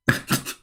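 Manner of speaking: brief breathy chuckle; real chuckle. Real, not acted. Streamer amused by the backfired cover-up, breathy chuckle. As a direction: laugh briefly under the breath.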